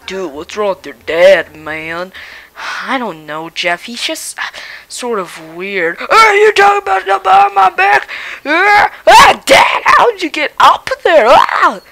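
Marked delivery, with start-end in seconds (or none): 6.0-11.8 put-on old man voice yelling loud; a cranky grandpa hollering out; shouting old man.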